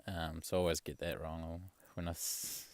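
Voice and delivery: ordinary talk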